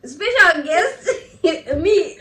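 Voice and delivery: laughing, surprised question. Real, not acted. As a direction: caught off guard, laughing. Surprised at being called special.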